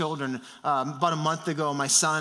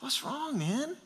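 soft, higher-pitched comforting voice; reassuring dad; 6 semitones higher, 7 dB quieter than usual. Retold or imitated. Dad to crying son in his room, softly reassuring. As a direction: gently soothe an upset child, light and hushed.

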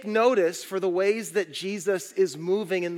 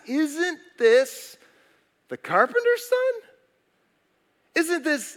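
incredulous question, pitched high; acted disbelief; 8 semitones higher, slower than usual. Preacher voicing Nazareth townsfolk doubting Jesus, high-pitched disbelief. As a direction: voice climbing sharply as if astonished.

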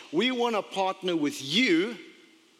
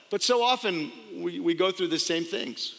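normal talking voice

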